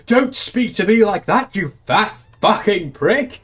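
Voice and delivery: high, loud, slow posh outburst; a posh judge losing his temper; posh furious judge. Retold or imitated. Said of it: "in, like, the most posh voice ever just goes".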